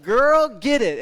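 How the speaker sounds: put-on girl get it yelled high and loud; loud cheer. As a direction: cheer the stranger on at full volume. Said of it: "I was like".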